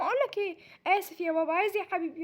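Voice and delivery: usual speaking voice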